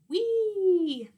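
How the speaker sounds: high, joyful cry while swinging; gleeful whee. Acted. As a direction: swoop down with delight. Swinging down on a vine.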